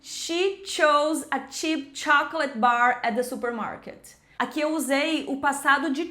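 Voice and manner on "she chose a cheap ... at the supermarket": slow, careful model for repeating; tongue twister demo. Shown as a demonstration. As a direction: take it slowly, contrasting SH in "She" with CH in "chose", "cheap", "chocolate". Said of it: "Vamos fazer um trava-línguas? Repete comigo". (trava-línguas devagar, para repetir)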